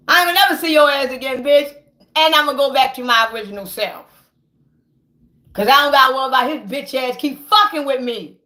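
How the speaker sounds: usual speaking voice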